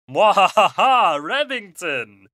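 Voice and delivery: slow, loud hammy villain cackle; sneering panto villain. Pretend. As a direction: gloat like a pantomime baddie. Villain cackle, spooky pivot.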